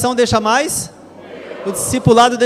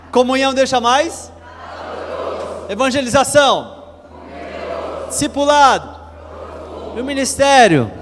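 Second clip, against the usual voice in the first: call and response; 3 semitones higher than usual. Shown as a demonstration. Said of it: "vamos falar de novo".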